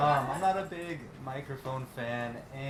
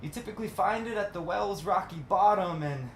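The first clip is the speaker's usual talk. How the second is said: higher, rolling spoken-word delivery; poetry recital; 7 semitones higher than usual. Acted. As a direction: recite it as verse, each phrase rising then falling. Resumes reading the poem.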